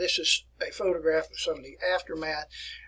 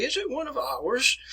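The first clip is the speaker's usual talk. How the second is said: high-pitched mock Scottish accent; imitated Scottish accent; 9 semitones higher than usual. Teacher quotes his Scottish running friend spotting a plane, imitated Scottish accent. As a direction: do a quick Scottish impression, pitched up. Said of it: "in that Scottish accent of his".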